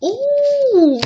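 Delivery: a high, delighted ooh at the card; surprised ooh. Real, not acted. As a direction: gasp of real surprise, pitched high. Reacting to a card reveal.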